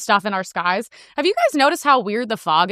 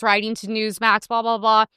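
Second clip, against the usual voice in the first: ordinary talk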